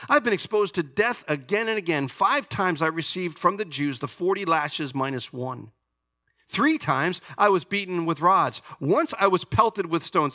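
high, loud scripture reading; fiery preacher reading scripture; preaching at full force. Acted. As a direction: proclaim Paul's boasts like a challenge. Reads Paul's boasts with force.

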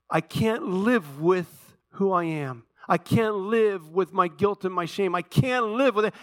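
a hint of a despairing man, slow and high; lightly voiced anguish of someone who can't go on; voicing despair. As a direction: cry out the hopeless words slowly. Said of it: "where we say".

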